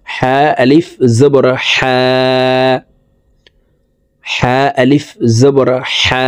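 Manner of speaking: slow spell-out with the final "হা" held long; one-alif stretch. Shown as a demonstration. Teacher demonstrating madd to viewers, stretched vowel. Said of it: "আমরা এক আলিফ টেনে পড়ব".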